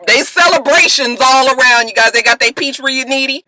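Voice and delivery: normal talking voice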